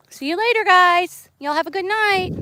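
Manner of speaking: high, loud sing-song goodbye. Real, not acted. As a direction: call out sweetly. Calling goodbye to animals.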